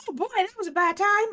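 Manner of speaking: high, fast cartoon mouse squeak; a quick squeaky cartoon mouse; Mickey Mouse voice. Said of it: "sounds a little bit like Mickey Mouse".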